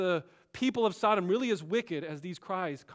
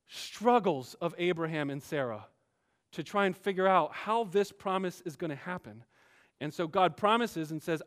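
normal talking voice

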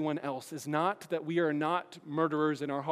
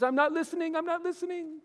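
put-on much higher, childish refusal; high whiny Smeagol covering his ears; 12 semitones higher than usual. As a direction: plug your ears and chant it high. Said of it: "Smeagol covers his ears".

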